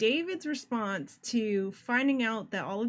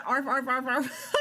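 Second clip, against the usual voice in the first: higher-pitched imitation barking, amused; mock dog bark; 4 semitones higher, 4 dB louder, slower than usual. Player reading out the woman's barks, playful dog imitation. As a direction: bark it out like a dog, half laughing. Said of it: "She's barking for him?".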